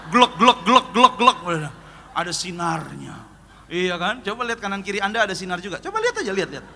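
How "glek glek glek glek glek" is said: 1 gulping sounds. Retold or imitated. Swallowing meatballs whole.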